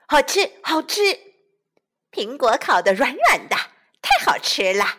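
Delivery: loud, delighted eating; munching happily. Acted. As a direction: savour it out loud.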